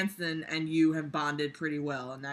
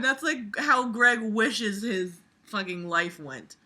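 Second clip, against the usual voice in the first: usual speaking voice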